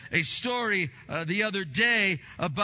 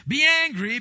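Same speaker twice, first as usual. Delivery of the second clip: very loud, high proclamation; preacher at full force; booming command; 10 semitones higher, 9 dB louder than usual. Acted. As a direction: shout it out from the pulpit.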